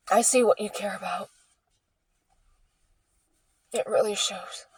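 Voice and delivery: breathy bitter aside under the breath; hushed, resentful remark; bitter whisper. Real, not acted. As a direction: let it slip out as a near whisper.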